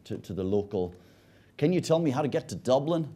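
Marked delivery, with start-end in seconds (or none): 1.6-3.1 lightly put-on American accent, a little higher and louder; a tourist asking directions in a mock American accent. Telling an Irish joke, the tourist's question in a mock American accent. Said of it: "That's my American accent".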